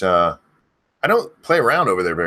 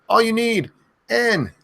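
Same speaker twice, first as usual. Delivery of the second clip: high yelled name; screamed name; 14 semitones higher, slower than usual. Acted. Streamer yelling a viewer's name, high yell. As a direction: call it out as a big yell. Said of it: "have me scream your name".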